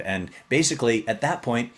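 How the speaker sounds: ordinary delivery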